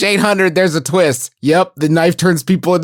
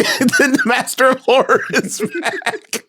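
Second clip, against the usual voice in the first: mock announcement; 5 semitones higher than usual. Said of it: "be like".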